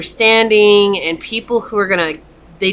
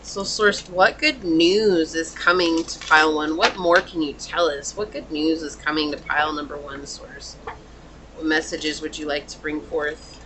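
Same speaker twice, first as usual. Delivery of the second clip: quiet invocation; 3 semitones lower, 8 dB quieter than usual. Real, not acted. Quietly asks Source while shuffling.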